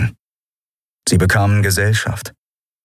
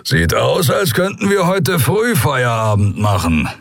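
grumble; 6 semitones higher than usual. Acted. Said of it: "brummte der Skria".

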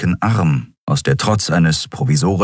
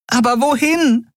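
high, fretful little voice; fretful fairy. Acted. Nelen asks Keru where to, high fretful. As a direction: ask in alarm.

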